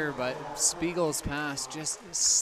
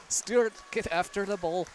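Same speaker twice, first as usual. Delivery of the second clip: loud, high mock Scottish accent; 7 semitones higher, 6 dB louder than usual. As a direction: bark it in a hammy Scottish accent. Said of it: "That's a terrible Scottish accent".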